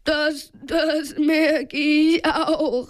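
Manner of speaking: slow, trembling stammer; acted quaking fear, drawn out; shivering. A narrator's reading. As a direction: shiver through the words. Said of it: "bibberte Peter".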